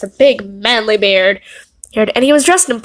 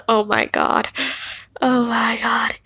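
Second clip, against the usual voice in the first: soft breathy oh my god; whispered disbelief; 6 dB quieter, slower than usual. Real, not acted. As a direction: mutter it under your breath. Disbelief muttered.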